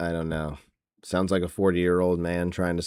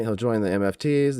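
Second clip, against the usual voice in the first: ordinary talk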